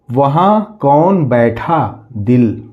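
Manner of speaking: slow, drawn-out reading of देखो वहाँ कौन; slow reading aloud. Shown as a demonstration. Teacher reading the decoded sentence to children, slowly. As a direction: spell it out slowly for the children, word by word.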